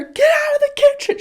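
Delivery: put-on very high, loud kid's shriek; child yelling. Mom imitating daughter yelling everyone out. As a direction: shriek at the whole family. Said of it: "She literally yelled".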